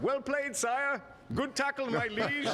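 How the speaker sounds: mock courtly voice. Teen mocking Prince William from the sideline, high hammed-up courtier voice. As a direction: play the fawning courtier to the prince. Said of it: "I was a young idiot teenager and I'm like".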